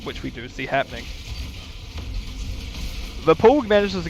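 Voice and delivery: fast, rising match commentary; live play-by-play. Acted. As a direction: call the action as it happens.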